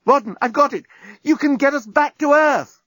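very high, triumphant cry; excited calling out. A narrator's reading. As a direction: announce a breakthrough, pitched right up. Calling out thrilling news.

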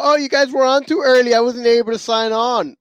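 hammed-up excuse voice; mocking Troy's excuse. Mimicking Troy making excuses for missing the show, mock whine. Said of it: "He's probably gonna, you know".

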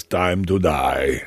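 slow, low movie-villain death line; Rutger Hauer. Retold or imitated. Quoting the dying android's last words, slow and low. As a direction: drag it out like a dying replicant. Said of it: "Rutger Hauer pulls the nail ... and says".

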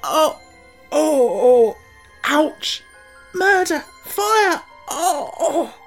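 very high, loud wails; howling in pain. A narrator's reading. Tip rolls about in pain from the pill, howling. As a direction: cry out as if writhing in agony. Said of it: "began to suffer such fearful pains".

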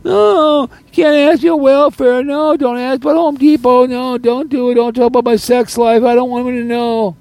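very high, hammed-up protesting voice; squealed mock pleading, way up high; mock chicken. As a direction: mimic a coward's panicked refusals in mocking falsetto. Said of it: "just downright chickened out".

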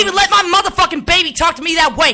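host blasting a guest on air, furious; furious rant. Acted. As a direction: chew them out hard. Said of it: "This is a real live snap".

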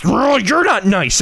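high, slow imitation of a kid; retorting child. Son won't let dad win, high drawn-out retort. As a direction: throw it back like an offended child.

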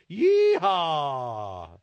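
drawn-out cowboy holler; yeehaw. Acted. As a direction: whoop it like a rodeo cowboy. Host's rodeo call.

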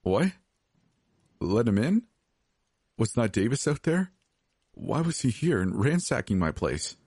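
confused and scared, voice climbing; alarmed disbelief. A narrator's reading. Confused frightened questions.

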